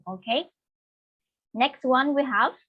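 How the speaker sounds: usual speaking voice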